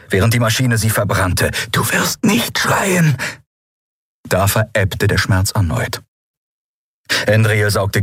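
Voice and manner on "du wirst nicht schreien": high, slow strained plea through pain; desperate self-command. A narrator's reading. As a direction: hold back the scream with each word. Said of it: "beschwor sie sich".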